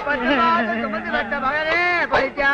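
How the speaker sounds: drawn-out, hammed-up lament; mock mourning wail. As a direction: stretch it out like a fake mourner. Fake mourners wail over supposed corpse.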